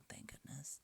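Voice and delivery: hushed unvoiced whisper, slowed; whispering so the swear stays unsaid. Real, not acted. Recording alone, he drops to a whisper after nearly swearing.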